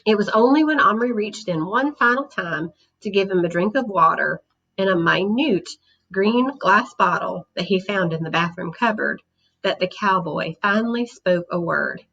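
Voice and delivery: ordinary delivery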